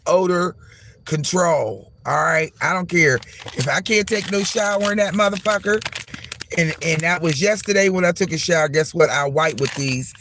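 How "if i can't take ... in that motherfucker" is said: normal talking voice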